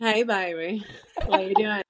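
wife greeting her husband home as a lightly voiced flirt; flirty trashy greeting. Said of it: "but be like".

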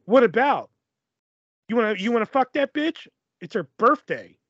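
hammed-up accusing back-and-forth; mock jealous quarrel. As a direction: imitate a jealous partner picking a fight. Acted jealous quarrel over phone call.

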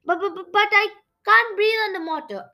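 high, panicky stammering; scared stammer. A narrator's reading. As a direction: stutter it out in a fright. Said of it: "I stammered".

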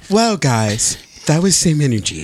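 flustered substitute teacher. Retold or imitated. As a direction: sound like a nervous sub. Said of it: "She be sounding like a substitute teacher".

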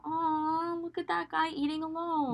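high, soft, drawn-out sympathy; pitying aww. Acted. Friend spotting a lone diner, high soft pitying voice. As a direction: coo with pity over a stranger.

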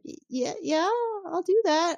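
high, hesitant yes; nervous agreement. Retold or imitated. Troi answering weird Picard, nervous high agreement. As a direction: agree uneasily to get away.

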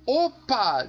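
loud high "欧巴" exclamation; joyful Greek cheer. Shown as a demonstration. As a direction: shout "欧巴" like a dancing Greek. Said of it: "怎么说？".

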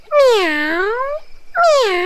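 very high comic meows; high cat meow; wasp meowing. Acted. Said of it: "muy enojada amenazó".

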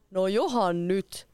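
slow, pleased king's murmur, drawn out; a pleased old king savouring the gift; king chuckling contentedly. A narrator's reading. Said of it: "myhäili kuningas mielissään".

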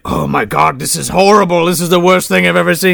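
lightly voiced horrified cop; hint of a shocked police chief; mock horrified chief. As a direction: act appalled at the drawing. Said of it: "he's like".

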